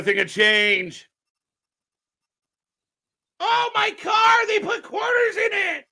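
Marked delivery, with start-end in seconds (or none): 3.4-5.9 hammed-up man wailing over his car; mock whiny wail. Streamer mocking the wrestler's car lament, whiny wail.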